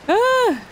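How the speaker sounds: sudden high scream; startled scream. Real, not acted. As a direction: shriek as the monster appears. Startled in horror game.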